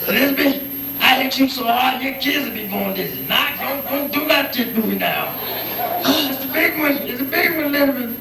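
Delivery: high, hammed-up TV old-timer parody; sitcom character. Calling out to Elizabeth, high mock-dramatic impression. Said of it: "I like sampling some of that".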